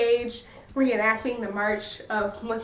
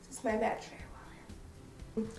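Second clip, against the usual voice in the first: whisper; 15 dB quieter than usual. Real, not acted. Aside about imaginary wallet.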